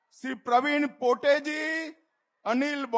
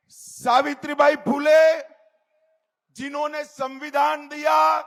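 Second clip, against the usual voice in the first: normal talking voice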